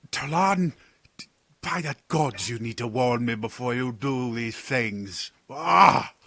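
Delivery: a rattled courtier tripping over his words; flustered advisor Sidon. Acted. Sidon nervously introduces himself to the party, stammering.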